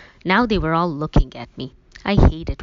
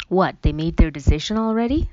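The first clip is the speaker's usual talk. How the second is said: ordinary delivery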